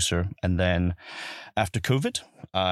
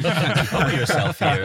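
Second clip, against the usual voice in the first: laughing; 8 semitones higher, 5 dB louder, slower than usual. Real, not acted. Laughing at the joke.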